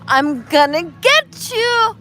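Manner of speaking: put-on dad's slow teasing threat, then girls' high squealing shrieks; hammed-up tickle-game shrieks; playful chase game. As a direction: act out a giggly chase. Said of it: "what if the game was like".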